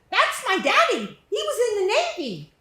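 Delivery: put-on British boy's voice. Little British boy asking the narrator about the game, high British accent. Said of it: "He had an accent. It sounded British".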